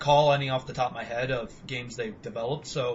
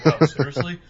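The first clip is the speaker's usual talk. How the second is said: louder, laughing Batman quote; laughing mock Batman; 7 dB louder than usual. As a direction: toss it off through laughter. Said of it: "Batman's like".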